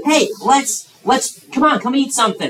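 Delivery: high, eager coaxing voice; coaxing, pleading. Acted. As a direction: coax him to come and eat. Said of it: "And so, like".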